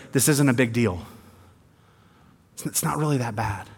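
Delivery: quiet mocking dismissal; sarcastic quote. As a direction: shrug it off with sarcasm. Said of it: "note the sarcasm in my voice".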